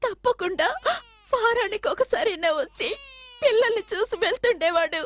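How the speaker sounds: crying through the account; tearful. Acted. Woman telling police her man is missing, tearful. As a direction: plead through tears, catching the breath.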